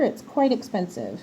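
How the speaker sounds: ordinary talk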